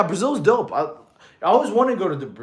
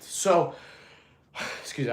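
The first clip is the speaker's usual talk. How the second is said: out of breath; 3 dB quieter than usual. Real, not acted. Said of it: "I was a little out of breath".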